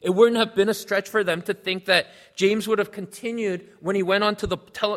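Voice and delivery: ordinary talk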